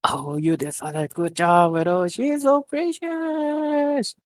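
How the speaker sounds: very high, slow squeal; gushing. A narrator's reading. Camilla gushing over Nero in a very high drawn-out squeal. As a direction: melt over something adorable.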